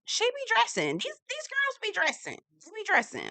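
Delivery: very high, admiring squeal; hyped, high-pitched hype-up; gushing praise. Real, not acted.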